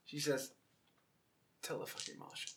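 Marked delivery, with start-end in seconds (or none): 1.6-2.5 whispered, much softer retort. Acted. Woman to the player threatening her, whispered defiance. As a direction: throw it back under your breath.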